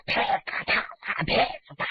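rapid voiceless popping smacks; storyteller's percussive fight noises; imitated fight blows. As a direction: make the blows with your mouth, no voicing.

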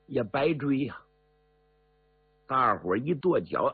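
plain reading voice

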